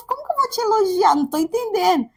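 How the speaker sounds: a mother who can't believe what she's hearing; exasperated disbelief. Retold or imitated. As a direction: throw up your hands at the absurd request. Exasperated mother answering son.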